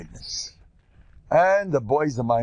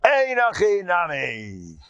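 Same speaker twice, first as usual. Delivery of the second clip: slow, high pronouncement; a heckler rising to proclaim; solemn retort; 5 semitones higher, slower than usual. Retold or imitated. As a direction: stand up and declare it slowly. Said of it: "and he said".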